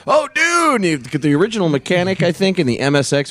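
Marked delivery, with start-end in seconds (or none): 0.1-0.8 high relieved exclamation; relieved prisoner. Retold or imitated. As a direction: sigh of relief, pitched up. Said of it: "Is he".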